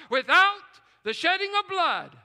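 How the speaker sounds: high and loud ringing declaration; full-force preaching cry. Real, not acted. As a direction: lift it up to the back row. Preacher's climax line.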